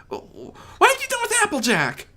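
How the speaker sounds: high, fast frightened filly voice; fearful demand. Acted. Apple Bloom asking Discord about Applejack, high and fast. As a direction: demand it, scared for her sister. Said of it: "Apple Bloom demanded fearfully".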